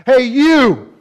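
loud, high shout at God; Samson's defiant call. Acted. Samson shouting at God, loud defiant demand. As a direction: call out demanding, like a wronged warrior. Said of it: "a different tone in the voice of Samson".

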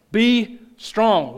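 loud, slow, ringing sermon cry; preaching at full force. Acted. Pastor proclaiming scripture to his church, loud ringing preaching.

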